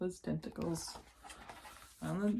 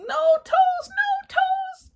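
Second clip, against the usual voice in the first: much higher, louder giggly squeal; giggling squeal; 12 dB louder, much slower than usual. Acted. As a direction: squeal through giggles as if tickled. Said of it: "and giggled".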